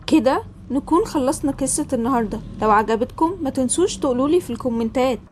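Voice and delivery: loud, upbeat sign-off to viewers; channel outro. Acted. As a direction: call out the likes and subscribe pitch. (ختام القناة بصوت عالي ومتحمس)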